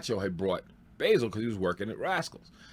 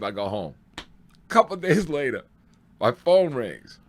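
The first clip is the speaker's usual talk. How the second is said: ordinary talk, nothing marked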